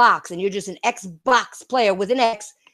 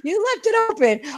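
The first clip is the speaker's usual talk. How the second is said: laughing; 9 semitones higher than usual. Real, not acted. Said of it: "I'm laughing so hard".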